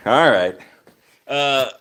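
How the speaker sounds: ordinary talk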